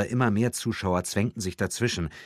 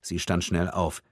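ordinary delivery

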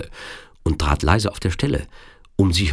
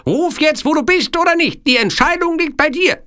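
very high, forceful villain voice; Sicilian villain's ultimatum; 4 dB louder than usual. Acted. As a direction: demand an answer in a piercingly high voice. Villain gives princess a last choice. (hohes Ultimatum des Sizilianers)

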